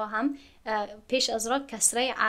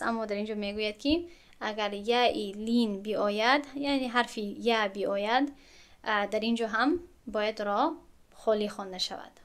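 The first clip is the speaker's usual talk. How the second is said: usual speaking voice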